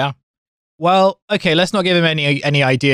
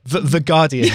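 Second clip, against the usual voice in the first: laughing. Real, not acted. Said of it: "You can say it".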